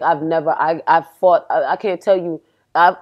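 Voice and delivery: ordinary talk, nothing marked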